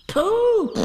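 loud, very high excited squeal; urgent high-pitched call; crying out. A narrator's reading. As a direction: yell the name in a piglet squeak. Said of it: "he cried".

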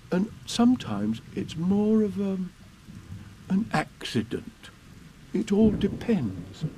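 lightly voiced slow bear; Pooh, hesitant. A narrator's reading. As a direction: explain haltingly, searching for words. Pooh explains his boat.

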